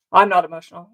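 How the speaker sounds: loud, short mock denial; retold protest. Speaker contradicting the relative mid-argument, retold protest. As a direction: cut back in insistently, as a retelling. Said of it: "I'm like".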